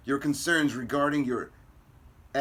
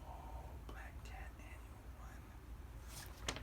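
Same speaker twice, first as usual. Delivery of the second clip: whisper; 23 dB quieter, much slower than usual. Real, not acted. Spots a rare comic.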